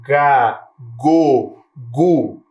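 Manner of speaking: "Ga, go, gu" each syllable separate and clear; teacher drilling the syllables "Ga, go, gu"; syllable demo. Shown as a demonstration. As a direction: sound out "Ga, go, gu" one by one. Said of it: "Você percebe que a vogal está bem aberta".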